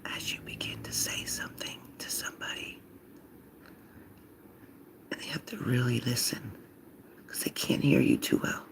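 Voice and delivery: near-silent whisper; talking very low. Shown as a demonstration. Showing Leo how to make somebody really listen, whispering. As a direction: drop into a hushed whisper they must strain for. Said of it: "you start to talk like this".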